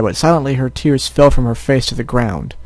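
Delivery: ordinary delivery, nothing marked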